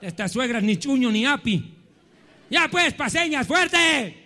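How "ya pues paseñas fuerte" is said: raised call with a stretched last word; goading shout. Real, not acted. Preacher prodding La Paz women to cheer, goading shout. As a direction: egg the crowd on impatiently.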